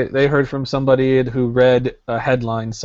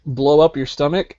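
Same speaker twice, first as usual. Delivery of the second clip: ordinary talk, nothing marked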